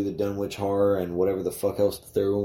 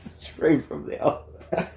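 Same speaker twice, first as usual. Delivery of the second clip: breathy laughing mock line; 8 semitones higher than usual. Real, not acted. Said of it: "savouring the gross detail".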